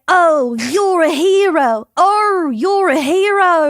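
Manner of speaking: high, slow gushing voice, hammed up; mock admirer. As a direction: gush over him in a silly high voice. Mocking people who call him hero.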